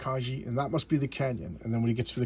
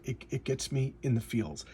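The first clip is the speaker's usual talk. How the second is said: ordinary delivery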